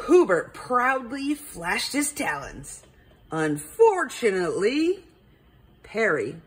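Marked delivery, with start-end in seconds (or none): none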